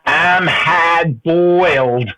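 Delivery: slow, drawn-out mock Australian dub line; lightly put-on Australian accent, very slow. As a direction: ham up an Australian dubbing voice. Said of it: "the guy with the Australian accent goes".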